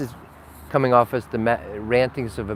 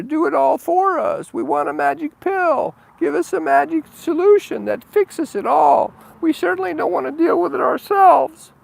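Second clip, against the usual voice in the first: whiny begging crowd; 3 dB louder than usual. Retold or imitated. Crowd in the hills begging Jesus, high whining plea. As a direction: beg like a needy crowd that wants everything done for them.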